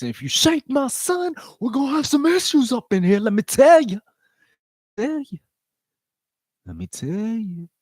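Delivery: a pretend father stepping up for his son; protective dad voice. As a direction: warn them off like an overprotective dad. Playing [name]'s protective father.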